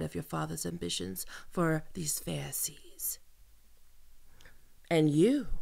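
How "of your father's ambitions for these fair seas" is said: boastful whisper. Acted. Boasting to captive.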